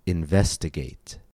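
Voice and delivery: Investigate with stress on VES; stress on second syllable. Shown as a demonstration. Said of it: "it falls on the second syllable".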